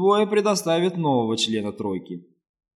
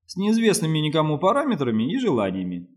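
ordinary delivery

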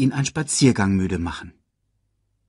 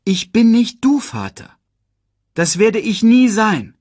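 loud sigh; 13 semitones higher, 9 dB louder than usual. Acted. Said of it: "seufzte er laut".

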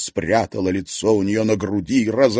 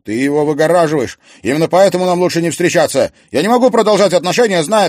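loud, fast, much higher yelling; angry shout; 10 semitones higher, 10 dB louder, faster than usual. Acted. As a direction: bark it back at her. Klim shouts back at Serafima. (гневный крик)

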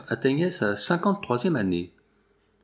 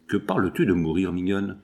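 ordinary delivery

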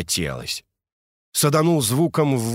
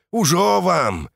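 threatening; 8 semitones higher, 3 dB louder, slower than usual. Acted. Threatening the city.

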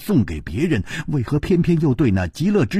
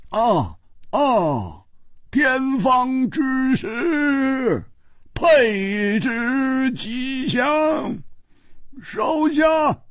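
high, very slow proclamation; jovial king; 11 semitones higher, much slower than usual. A narrator's reading. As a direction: call it out grandly, stretching every word long and high. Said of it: "又笑着说".

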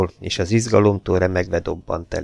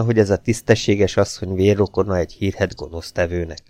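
ordinary delivery

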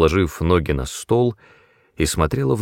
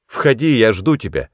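bright higher-pitched call to come in; cheerful call; 4 semitones higher, 3 dB louder than usual. A narrator's reading. Ольга hears a knock at the door, cheerful call. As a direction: call out merrily, lifting the pitch. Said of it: "весело крикнула".

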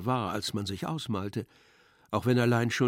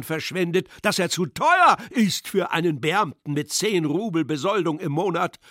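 high, loud raging tirade; furious roar; 6 semitones higher, 6 dB louder than usual. Acted. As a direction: roar your fury at the general. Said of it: "brüllte mit ungezügelter Wut". (brüllend vor ungezügelter Wut)

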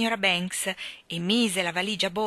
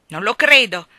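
blaring, much louder disbelief; loud shout; 3 semitones higher, 14 dB louder than usual. A narrator's reading. Mr Banks on the phone to his wife, loud disbelieving shout. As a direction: bellow it down the phone. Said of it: "strepitò la voce del signor Banks".

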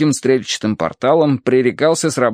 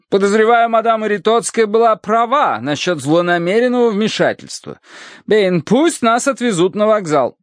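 high, emphatic matron's voice; decide it firmly in a high voice; imperious matron; 11 semitones higher than usual. A narrator's reading. Mrs. Mering orders her butler to leave, imperious matron.

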